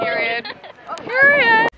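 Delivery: very high, loud sassy "Period."; sassy emphasis. Real, not acted. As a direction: punch "Period." with attitude. Playful emphatic affirmation.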